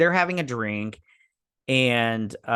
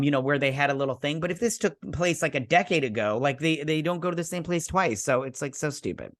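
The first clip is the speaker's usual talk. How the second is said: ordinary talk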